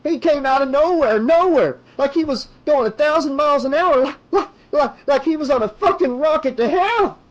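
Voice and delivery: high, loud, frantic rant; hysterical screaming. A narrator's reading. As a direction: scream it in shock, stumbling over words.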